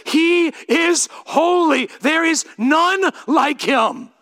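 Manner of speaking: high, slow, ringing declaration; preacher's full-force proclamation; proclaimed loud. Acted. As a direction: proclaim it to the back row. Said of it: "it says".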